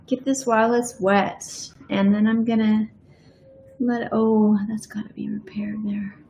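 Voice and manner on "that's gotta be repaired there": whispered note to self. Real, not acted. Painter whispering to herself about a flaw, hushed. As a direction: barely breathe it.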